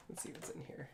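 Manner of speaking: under-the-breath whisper. Real, not acted. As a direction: whisper it to yourself while rummaging. Muttering to self while searching.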